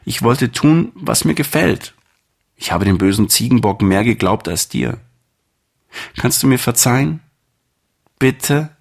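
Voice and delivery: high, small lamb voice, full of remorse, bleating at the start; a guilty little lamb begging forgiveness; little lamb pleading. Acted. Lamb begs shepherd's forgiveness. (hohe Lämmchenstimme, reumütig und kleinlaut)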